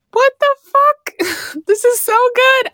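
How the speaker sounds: amazed disbelief. Retold or imitated. Telling a friend how hooked she got, in high amazed disbelief. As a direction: gasp it out like you can't believe how good this is. Retells her hooked reaction.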